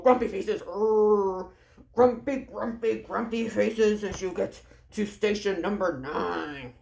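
low, growly grumpy chant. Acted. Leader walking kids to station nine with grumpy faces, growly. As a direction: play the sulky grump for the kids. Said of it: "let's have our grumpy faces".

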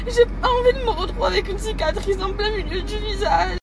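tearful, voice high and breaking; crying. Real, not acted. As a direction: speak through real tears, pitch up. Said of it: "vous savez pourquoi je pleure".

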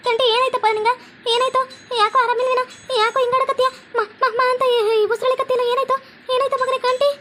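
panicked mother. Acted. Mother finds son struggling to breathe.

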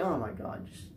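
ordinary delivery

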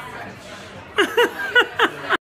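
high airy burst of laughter; giggling fit; breathless laugh. Real, not acted. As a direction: laugh it out without voice. Laughing at the memento joke.

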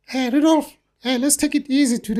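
high squeaky referee voice. Retold or imitated. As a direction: squeak it out like the ref. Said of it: "with his squeaky voice".

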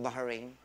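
"Bahrain" said as Ba-ha-rain; joke pronunciation. Shown as a demonstration. Speaker teases a visitor on the right pronunciation, "Bahrain" as Ba-ha-rain. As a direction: say "Bahrain" softly in three syllables, Ba-ha-rain. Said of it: "Di ba ang tamang pronunciation".

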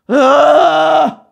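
high, loud drawn-out "ah"; loud cry. Real, not acted. Fan overcome by [name]'s lyrics, crying out loudly. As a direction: let out a sudden loud wail. Outburst after reciting lyrics.

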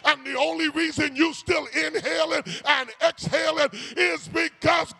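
rising, shouted preacher's build; preaching. Acted. Preacher driving the point home to the congregation, shouting. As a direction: preach it with mounting fire.

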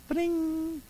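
chirpy high mock ad ding; sparkle sound effect. Pretending a toothpaste ad's shine with a ding. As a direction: sing out a bright ding like a commercial.

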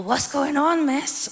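lightly voiced, meek Irish lad in bed; timid Irish lad. An innocent Irish lover asking his date, in a meek Irish voice. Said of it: "they're all".

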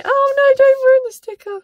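high, loud alarmed cry; panicked squeal. Real, not acted. Peeling a sticker, panicking it will rip, high yelp.

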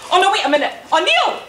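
panicked cry. Acted. Maisie panicking upside down in machine.

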